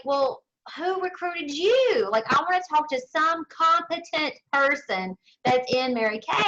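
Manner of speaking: mock-outraged, demanding a competent recruiter; incredulous demand. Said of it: "And I was like".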